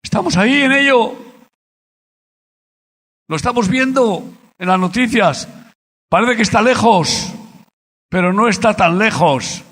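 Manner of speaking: fiery preacher warning the congregation; preaching at full force. Real, not acted. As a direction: thunder it from the pulpit.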